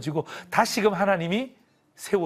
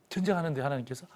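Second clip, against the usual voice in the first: ordinary delivery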